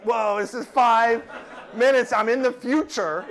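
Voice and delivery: loud, high, drawn-out wonder; mock amazement. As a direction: play astonished time traveller, hammed up. Acts amazement at time travel.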